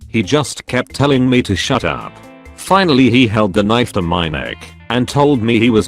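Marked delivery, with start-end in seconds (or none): none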